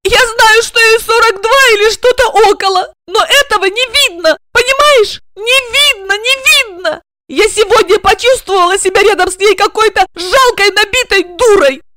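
high, loud agitated outburst; agitated protest. A narrator's reading. Regina protests to Igor about Olga's age, high and loud.

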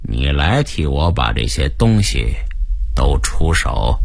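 low, slow villain's order; commanding gang boss. Acted. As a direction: give a low, deliberate command. (老大低沉缓慢地下令)

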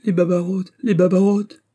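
put-on high, alarmed old servant's cry; cry of distress. A narrator's reading. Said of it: "poussa un cri de détresse".

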